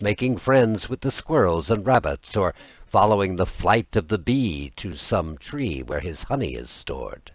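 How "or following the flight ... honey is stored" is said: ordinary delivery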